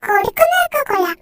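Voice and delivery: put-on cheeky boy shouting goodbye as he runs off; cheeky child. Son teasing his dad and running away, loud high child voice.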